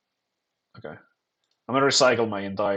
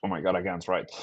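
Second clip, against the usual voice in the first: ordinary delivery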